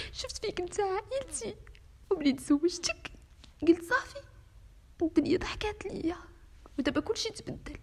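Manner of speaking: high, tearful voice of a betrayed wife, breaking on the questions; tearful pleading. Acted. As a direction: plead with him through tears, voice cracking high. Wife confronts husband, betrayed.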